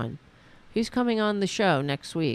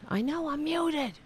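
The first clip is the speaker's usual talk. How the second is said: high-pitched exasperated retort; 10 semitones higher than usual. Real, not acted. Streamer answering viewers about being muted, exasperated. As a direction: answer back in a raised, fed-up pitch.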